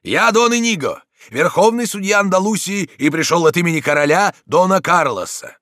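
high, loud proclamation; commanding shout. A narrator's reading. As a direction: announce your authority over a brawl. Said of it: "крикнул он нападавшим". (громко, властным криком)

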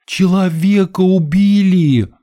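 high, slow melodramatic wail; drawn-out overacted cry; theatrical amateur actress. A narrator's reading. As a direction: proclaim it like a bad diva. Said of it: "И театральная пауза".

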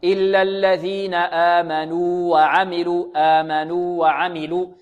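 آمنوا وعملوا slowly, long "nuu" then clear "wa"; separated waws. Shown as a demonstration. Teacher repeating the same rule, separated waws. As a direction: chant it with the first waw held. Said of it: "هذه مثلها تمامًا".

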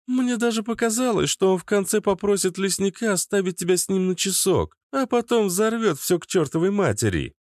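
teasing young woman, lightly mocking; high teasing female voice. A narrator's reading. Girls mocking the gnome.

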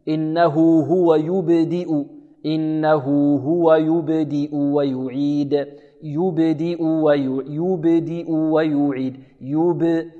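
slow, soft recitation with a full yoo opening "يبدئ"; careful model recitation. Shown as a demonstration. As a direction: draw out "يبدئ ويعيد" slowly, pure oo vowels and bounced ب. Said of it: "والمحافظة على ضم الياء". (تلاوة متأنية هادئة بضم تام في ياء "يبدئ")

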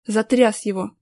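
plain reading voice

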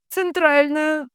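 high, stretched operator call; high wailing switchboard voice; droning switchboard medium. A narrator's reading. Figure of Dunya on air.

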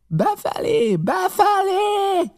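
high yell, stretched long on the repeat; impatient, drawn-out bellow; roared order. A narrator's reading. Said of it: "ordított".

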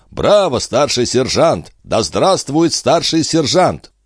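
high, slow, drawn-out cheers; cheering acclamation. A narrator's reading. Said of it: "а также возгласами". (торжественные возгласы толпы)

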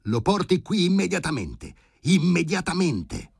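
high, loud urgent command; worked-up high-pitched yell down the phone; agitated shouting. A narrator's reading. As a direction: shout the order, stretching the repeat. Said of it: "prese ad agitarsi".

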